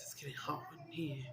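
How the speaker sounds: whispered joke aside. Pretend. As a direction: hush it like a secret.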